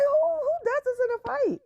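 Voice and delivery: shrill disbelief, pitch leaping way up; high-pitched mock outrage at the fight; high incredulous squeal. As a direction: squeak it out like you can't believe it. Incredulous self quoted mid-story.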